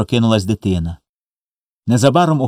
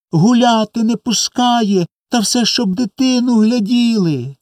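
put-on high, slow, chanting childish complaint; tearful reproach; 12 semitones higher, slower than usual. A narrator's reading. Orphaned boys tell mother their grievances, reproachful. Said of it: "з докорами, перебиваючи один одного, поспішали розказати".

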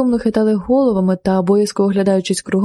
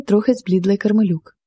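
ordinary delivery

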